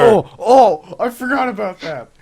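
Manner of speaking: very high, loud outburst of remembering; excited realization. Real, not acted. Friend suddenly remembering the special game mode, excited outburst. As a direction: burst in with sudden recall, pitch way up.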